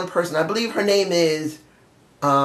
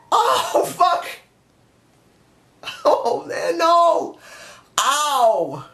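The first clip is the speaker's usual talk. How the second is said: high, pained cries after hurting yourself; yelping in pain; 9 semitones higher, much slower than usual. Real, not acted. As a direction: cry out as if your skull is ringing. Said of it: "That freaking hurt".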